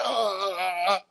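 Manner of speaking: soft strained grunts, voice cut off; imitated taser shock grunts. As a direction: grunt like being tased. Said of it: "I was like".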